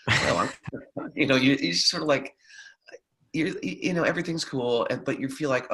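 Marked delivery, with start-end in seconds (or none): none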